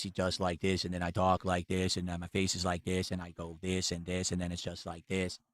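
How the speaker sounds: low, soft drone with no inflection; monotone imitation. Streamer mocking how another man talks, in a monotone. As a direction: drone it out without any emotion. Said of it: "very monotone, no inflection in his voice".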